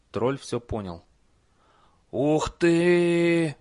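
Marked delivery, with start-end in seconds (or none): 2.1-3.6 high, loud breathed wow; amazed gasp. Acted. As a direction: breathe out in awe. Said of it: "выдохнул он".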